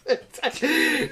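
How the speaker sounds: real burst of laughter; high laugh. Real, not acted. Laughing at the girlfriend's packing list, high laugh. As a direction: cracks up at his own story.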